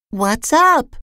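small, high-pitched question; high little storybook voice; little character voice. A narrator's reading. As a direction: ask it way up high, as someone tiny. Tiny character asks the tall one.

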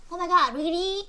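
ordinary delivery

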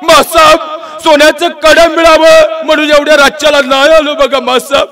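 high, choked voice on the edge of crying; tearful plea. Acted. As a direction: pour it out through tears, pleading. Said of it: "खळखळणाऱ्या डोळ्यांनी ते पोरगं उत्तरलं". (डोळ्यात पाणी आणून, रडवेल्या आवाजात विनवणी)

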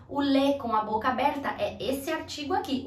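ordinary delivery

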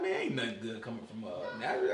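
low, quiet, doubting mutter; scoffing Nathanael. Acted. Preacher voicing a sceptical Nathanael, low scoff. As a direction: dismiss Nazareth under your breath.

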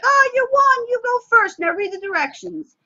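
very high and loud delighted cheer; excited cheer. Shown as a demonstration. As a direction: gush with delight at the child. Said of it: "And I'm like".

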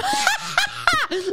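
high-pitched cackling laugh; demonstrative laugh. Shown as a demonstration. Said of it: "That's what I look like when I'm".

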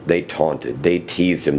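ordinary delivery

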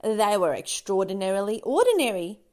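usual speaking voice

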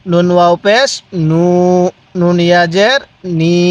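lilting letter names, then "নু" held level; measured spelling chant with long "নু"; drawn-out vowels. Shown as a demonstration. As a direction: spell it out and lengthen "নি". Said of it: "পড়ুন".

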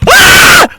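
loud, high-pitched yell; shrieked "Aa!" at full voice; sudden scream. Real, not acted. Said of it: "neden bağırıyorsun?".

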